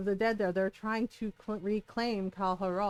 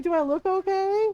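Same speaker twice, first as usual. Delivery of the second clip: high, loud sarcastic question; sarcastic retort; 9 semitones higher, 6 dB louder than usual. Retold or imitated. Gamer quoting her annoyed partner, high sarcastic question. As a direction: throw it back, incredulous. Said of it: "And he's like".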